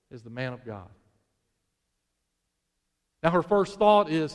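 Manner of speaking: ordinary talk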